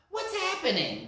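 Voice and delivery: high calling rabbit voice; high little rabbit calling out. Acted. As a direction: call out to the runners. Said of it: "the third rabbit called out".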